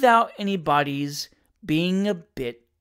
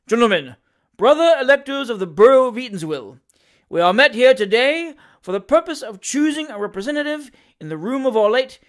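louder, higher strained oration; forced loud speech; 5 semitones higher, 7 dB louder than usual. A narrator's reading. Mayor addressing the electors, forced loud oration. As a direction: force the voice over the noise. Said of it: "as loud a pitch as he could possibly force".

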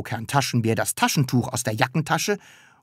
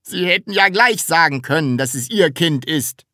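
raised, higher, indignant; angry; 5 semitones higher, 5 dB louder than usual. A narrator's reading. Herr Groll to Taschenbier about the child, angry. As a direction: scold with irritation. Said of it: "sagte Herr Groll wütend".

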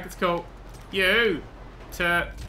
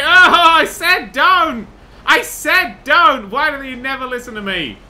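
loud, high drawn-out mock outrage; exasperated scolding; 7 semitones higher, 9 dB louder, slower than usual. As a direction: yell at players who never listen. Scolds game players who disobey.

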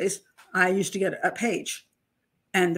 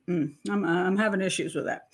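ordinary talk, nothing marked

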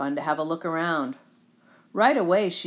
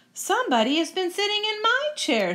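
high-pitched indignant mother bear; Mama Bear; 13 semitones higher, 3 dB louder, faster than usual. Acted. Mama Bear finding her chair used, high indignant voice. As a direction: lift the pitch right up, put out. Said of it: "said Mama Bear".

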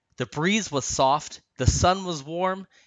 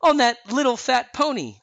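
high, loud amused delivery; a touch of mirth, pitched high; chuckling; 10 semitones higher, 6 dB louder than usual. A narrator's reading. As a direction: tease with mild amusement. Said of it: "said with a chuckle".

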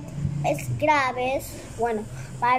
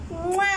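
blown kiss; 3 semitones higher than usual. Real, not acted. Host thanking viewers after saying she loves them, blown kiss. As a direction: blow the audience a spoken kiss.

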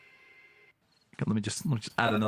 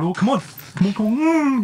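frantic; 9 semitones higher, 7 dB louder than usual. Real, not acted. Frantic fight moment.

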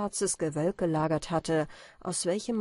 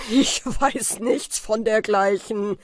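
wild lachend; 3 semitones higher, 7 dB louder, slower than usual. A narrator's reading. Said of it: "rief Falke wild lachend".

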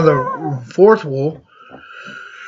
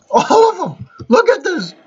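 being chased. Real, not acted. Mobs chasing him in game.

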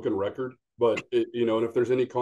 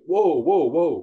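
loud half-joking "whoa, whoa, whoa", rising then falling; a playful brake on a pushy buyer; mock startled whoa; 4 semitones higher, 6 dB louder, slower than usual. Retold reaction to pushy buyer.

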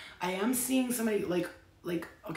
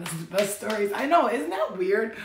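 excited; 9 dB louder than usual. Real, not acted. Excited aside.

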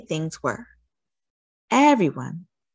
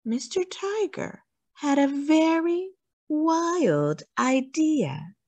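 building suspense; 9 semitones higher, slower than usual. A narrator's reading.

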